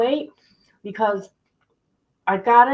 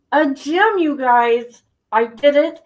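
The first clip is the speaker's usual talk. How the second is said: higher and louder, bursting with pride; proud excitement; 5 semitones higher, 6 dB louder, slower than usual. Real, not acted. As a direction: announce it like big news to friends. Proud news of joining a gym.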